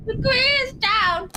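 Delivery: high, slow loud call. Real, not acted. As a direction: announce it dramatically. Calling out game event.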